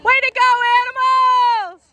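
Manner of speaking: long loud high cheer; yelling from the sidelines. Real, not acted. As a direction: holler praise to the team.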